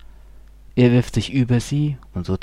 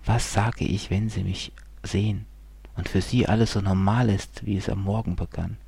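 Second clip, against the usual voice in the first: usual speaking voice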